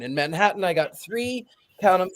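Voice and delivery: usual speaking voice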